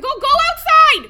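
loud high command; shouting. Acted. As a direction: yell it at him. Mom shouting son outside.

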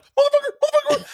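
high, loud panicked cry; squealing panic at the sight of the brother. Retold or imitated. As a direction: blurt it in terror. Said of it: "I mean, real panic".

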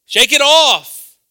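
very loud, high, slow shout; ringing shout. Real, not acted. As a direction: shout it out like a rallying cry. Preacher exhorting the congregation.

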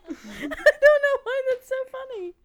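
high, laughing too hard to talk; real giggling fit, pitch way up; helpless laughter. Real, not acted. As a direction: squeeze it out through real laughter. Cracking up at the quote.

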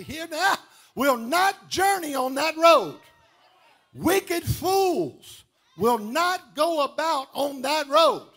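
loud, drawn-out scripture declaration; preaching the road to holiness. Acted. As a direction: proclaim the verse with force.

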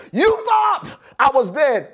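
high, stretched pulpit shout; shouted triumph from the pulpit; defiant preaching shout. Real, not acted. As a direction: throw it defiantly at the doubters. Preacher repeats his climactic line.

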